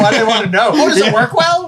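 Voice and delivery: squeaky mocking voice; mock high voice. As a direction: mimic in a silly high voice. Mocking the caller's question.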